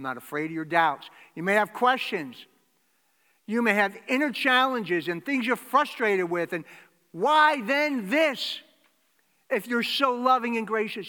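loud, high, driving sermon voice; preaching at full force. Acted. Preacher to congregation, the risen Jesus greeting them, loudly. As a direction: proclaim it to the back row.

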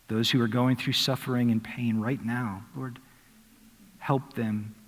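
soft, reverent pastoral prayer; closing prayer. Acted. Pastor praying for those in pain, hushed and reverent. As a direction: pray quietly over the congregation.

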